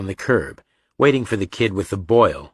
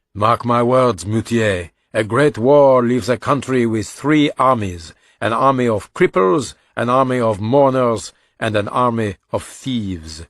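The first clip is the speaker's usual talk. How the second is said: put-on higher, louder, weighty voice of an old brigadier; one-armed brigadier's grim pronouncement; 3 semitones higher, 4 dB louder than usual. A narrator's reading. Brigadier to Moutier on demobilization day, lugubrious declamation. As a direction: declaim it like a lugubrious officer's warning.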